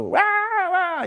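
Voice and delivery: put-on very high wailing baby cry; imitating a toddler's tantrum wail; mock baby crying. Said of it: "and you go".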